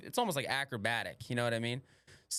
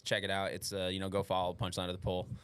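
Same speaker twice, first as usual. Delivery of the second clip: usual speaking voice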